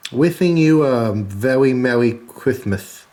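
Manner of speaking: slow lisping "wishing", "very Merry Christmas" with th for s and l for r; mock lisp. Shown as a demonstration. Reading the card's greeting in a babyish lisp. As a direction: lisp it slowly like a little kid. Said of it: "I'm lame".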